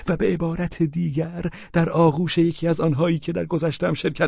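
Pavel Pavlovich voiced. (صدای پاول پاولویچ، زیر و چاپلوس)

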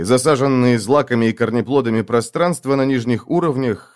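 ordinary delivery

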